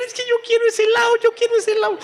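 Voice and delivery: put-on very high, fast whiny kid's wail; child throwing a tantrum, squealing high. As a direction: throw a mall tantrum for ice cream. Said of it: "comienza a patalear".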